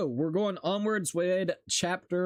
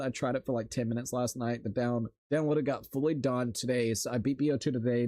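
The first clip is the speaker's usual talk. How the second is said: normal talking voice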